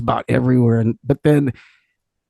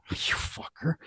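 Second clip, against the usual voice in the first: hushed, voiceless mock curse; mock cursing under breath; 2 semitones higher, 14 dB quieter, slower than usual. [name] to singer after [name]'s offer, whispered mock curse.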